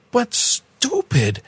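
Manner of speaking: stupid stretched slow and high; "stupid" dragged out high in disbelief; drawn-out emphasis. Real, not acted. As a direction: lean hard on "stupid", slowly and high. Exasperated punchline on key word.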